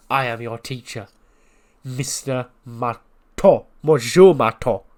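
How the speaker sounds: hammed-up French teacher accent; grand French schoolmaster, drawn-out pauses. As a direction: lecture slowly in a mock French accent. French teacher role, room of French flags.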